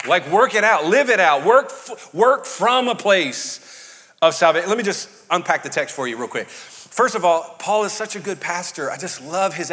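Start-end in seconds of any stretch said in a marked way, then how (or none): 0.3-4.7 loud, pounding each work; emphatic. Shown as a demonstration. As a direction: drive home work it out. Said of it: "this is not work for your salvation".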